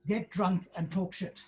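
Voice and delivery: ordinary talk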